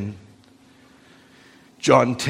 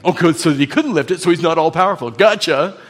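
louder, rapid mock gotcha; smug challenger; 3 semitones higher, 5 dB louder, faster than usual. A sceptic triumphantly trapping a believer, smug gotcha. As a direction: rush to spring the trap.

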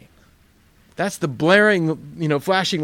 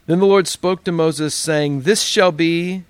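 louder, solemn reading of the text; scripture reading; 3 semitones higher, 7 dB louder, slower than usual. Acted. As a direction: read the Bible aloud to the church.

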